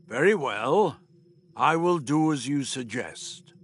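measured, weighty reply; unseen being. Acted. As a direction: agree with slow, formal dignity. Refusian agrees to Doctor.

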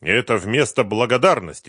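bristling back; angry. Acted. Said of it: "разозлился в свою очередь Самвелов".

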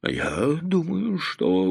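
a scared daughter trying to speak; timid, halting and hushed; frightened. A narrator's reading. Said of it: "в испуге сказала растерянная Лида".

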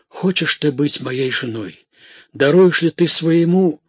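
pleading, rising to high and loud; urgent plea. A narrator's reading. Theodore proposes to dying Matilda, in urgent plea. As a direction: build to an ardent appeal.

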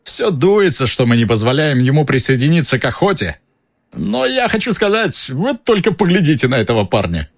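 high, hearty mocking voice; boisterous ghost jeering at a rival; jovial ghost knight. Sir Patrick's voice continues.

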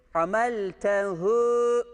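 "Hameltehu" slow, ḥ from the throat; drawn-out tajweed. Shown as a demonstration. Teacher shows students the throaty ḥ in "Hameltehu". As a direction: stretch "Hameltehu" carefully. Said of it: "ha'ya dikkat ediyoruz".

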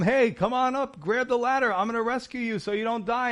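loud, high call from above. Retold or imitated. Helicopter rescuer calls down to the stranded man, loud and high. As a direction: shout down to the man over the rotor noise. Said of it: "calls out to him".

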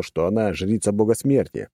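ordinary delivery

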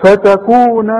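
فتكون with a long final NAA; full alif. Shown as a demonstration. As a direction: hold the last vowel of فتكون. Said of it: "عندك ألف اهي كاملة".

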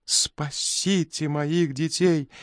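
high, slow desperate wail; frantic sobbing scream. A narrator's reading. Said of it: "иступлённо закричала она, рыдая".

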